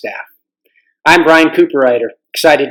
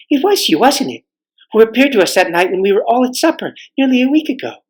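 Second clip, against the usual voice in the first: put-on higher, eager girl's voice; young girl; 7 semitones higher than usual. Girl asks the king if it was him, higher eager voice. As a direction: ask it brightly like a schoolgirl.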